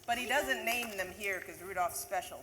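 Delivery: ordinary delivery, nothing marked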